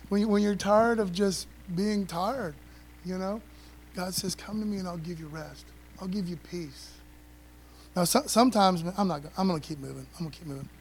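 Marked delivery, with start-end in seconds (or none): 4.4-6.9 soft, gentle promise of rest; God speaking softly to the weary; tender God voice. Acted. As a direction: offer rest like a caring father.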